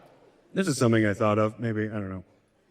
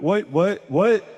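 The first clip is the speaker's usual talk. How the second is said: startled what; 5 semitones higher, slower than usual. Retold or imitated. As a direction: jolt awake, caught not listening. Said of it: "I'd be like".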